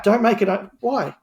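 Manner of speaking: ordinary talk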